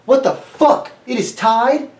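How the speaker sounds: loud, high-pitched mock outrage; stunned disbelief. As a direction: act out waking up shocked, voice jumping up high. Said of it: "you wake up and you're like".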